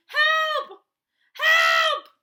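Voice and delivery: very high, loud desperate shouts; very loud calls. Acted. Paddington stuck in the bath, yelling loudly for help. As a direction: yell as loud as you can. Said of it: "then very loudly".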